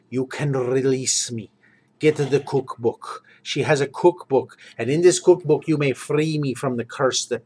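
put-on Slavic-accented guard pleading with the party. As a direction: Ratbor asking for release, quietly. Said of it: "Ratbor talks like this, with this accent".

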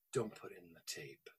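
a still small voice telling him to stop; hushed inner voice. Retold or imitated. The Lord warning the driver off the tape, hushed and low. Retold voice of God in car.

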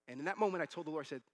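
normal talking voice

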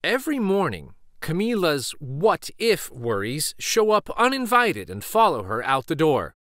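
ordinary delivery, nothing marked

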